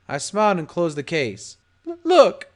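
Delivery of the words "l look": high, loud, stammering start; nervous stammer. A narrator's reading. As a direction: blurt it out in a panic. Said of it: "started talking nervously".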